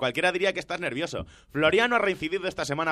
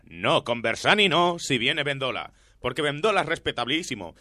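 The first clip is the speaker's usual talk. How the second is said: ordinary delivery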